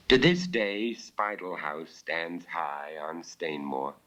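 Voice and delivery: ordinary delivery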